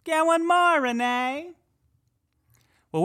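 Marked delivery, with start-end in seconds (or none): 0.0-1.5 very high, far-off spooky voice; ghostly imitation. Host spookily echoing the EVP for listeners, high ghost voice. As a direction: moan it like the recording.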